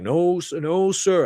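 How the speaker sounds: meek yes-sir in Music Man's voice; Music Man impression. Answering the threatening man in mild Music Man voice. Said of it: "I'm still doing a Music Man impersonation".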